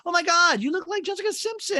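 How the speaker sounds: a very high, gushing oh my God; gushing squeal. Retold or imitated. Catherine gushing at Madison in a squealing high voice. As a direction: squeal the compliment like a giddy fan. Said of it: "Catherine goes".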